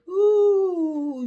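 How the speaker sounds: a sudden high, loud ooh. Real, not acted. Teasing a friend about rough days, high loud ooh. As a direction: tease her with a sharp high ooh.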